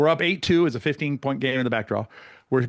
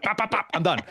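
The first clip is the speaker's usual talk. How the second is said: mock quick volley; 6 semitones higher than usual. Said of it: "What are you gonna do about it?".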